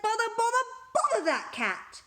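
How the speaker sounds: high, loud exasperated scolding; cross parents. A narrator's reading. Parents woken by Debbie, loud and exasperated. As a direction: spit out the repeated bothers, fed up. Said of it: "Mister and Missus Thomas said".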